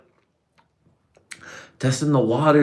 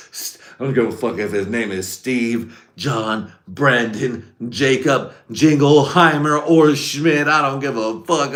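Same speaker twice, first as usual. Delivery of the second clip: names piled up, getting louder and higher; building mock rant; 3 semitones higher, 4 dB louder, slower than usual. Telling the homie no name matters, building to a yell. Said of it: "I was like".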